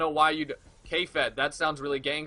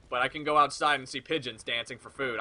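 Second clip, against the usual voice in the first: usual speaking voice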